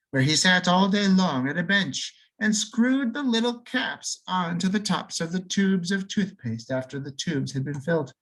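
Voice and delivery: ordinary delivery, nothing marked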